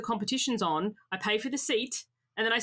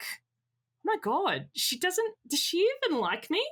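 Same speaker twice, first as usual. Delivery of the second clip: hurt disbelief; 6 semitones higher than usual. Retold or imitated. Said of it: "I felt like".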